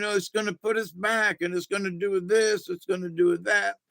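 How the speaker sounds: hammed-up griping voice; mock complaining. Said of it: "bitching and complaining".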